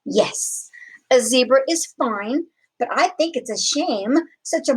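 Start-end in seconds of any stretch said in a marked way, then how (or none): none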